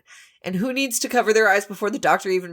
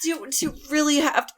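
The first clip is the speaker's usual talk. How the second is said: fast pleading; 6 semitones higher, much faster than usual. Acted. Playing patient getting shot.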